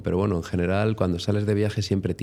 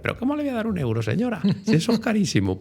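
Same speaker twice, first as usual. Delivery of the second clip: much higher, fast indignant mock protest; friend protesting; 12 semitones higher, faster than usual. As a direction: rattle off the friend's outrage in a squeaky voice. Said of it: "Y él decía". (amigo protestando a la señora, agudo y rápido)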